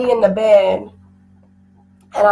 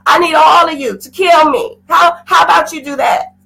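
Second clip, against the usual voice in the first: loud, higher, insistent cry demanding death; desperate demand; 4 semitones higher, 3 dB louder than usual. Retold or imitated. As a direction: shout through grief, demanding. Demanding death from God, shouting.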